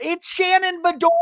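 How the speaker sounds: very high, loud mock scream; Heather screaming out. Heather announcing Shannon at the airport, imitated scream. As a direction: scream the name out, hammed-up. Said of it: "then Heather screams out".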